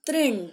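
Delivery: "तृण" loud and distinct as "trin"; word reading drill. Shown as a demonstration. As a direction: model "तृण" with a clear "tri". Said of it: "क्या पढ़ा मैंने उसे?".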